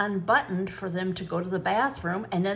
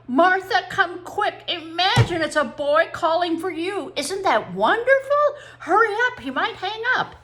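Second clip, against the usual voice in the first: high, loud frantic call; desperate shout; 9 semitones higher, 7 dB louder than usual. A narrator's reading. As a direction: holler urgently for a sister. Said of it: "Frank would shout desperately".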